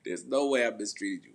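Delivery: normal talking voice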